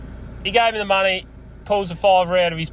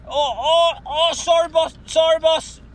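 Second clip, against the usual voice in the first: higher, fake-sorry grovelling voice; mock crackhead apology; 9 semitones higher, slower than usual. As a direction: play a shifty buyer caught out, pleading.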